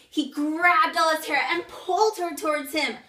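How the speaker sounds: loud, high, urgent retelling; alarmed outcry. Acted. Acting out the frightening attack.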